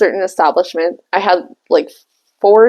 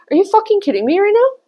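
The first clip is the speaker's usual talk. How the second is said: higher, fast disbelief; disbelieving outburst; 10 semitones higher, faster than usual. Retold or imitated. Her shocked reaction to the client's news. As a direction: blurt it out in disbelief.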